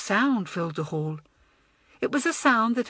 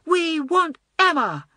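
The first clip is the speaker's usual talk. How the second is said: high, slow, louder chant; rallying cry; 10 semitones higher, 5 dB louder than usual. A narrator's reading. Naughtiest girl rallying the school for Emma, crying out. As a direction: call it out to start a chant. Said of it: "cried the naughtiest girl".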